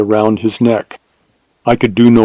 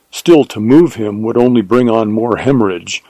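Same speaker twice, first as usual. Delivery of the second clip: ordinary delivery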